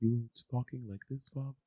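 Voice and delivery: barely audible, hushed imitation; very soft, feather-light murmur; post-surgery voice. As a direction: imitate someone sparing their sore throat. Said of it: "when you first came out of your surgery".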